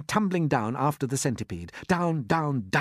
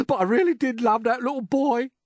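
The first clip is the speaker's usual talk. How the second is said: high, loud tearful lament; earthworm's hammed-up sob; sobbing; 13 semitones higher, 6 dB louder than usual. A narrator's reading. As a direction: blubber with grief over a lost friend. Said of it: "the earthworm sobbed".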